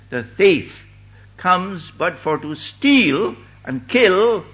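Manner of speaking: loud, drawn-out Scripture proclamation; full-force preaching. Acted. Preacher warning a congregation about the thief, proclaimed forcefully. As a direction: thunder out the key words, stretching them.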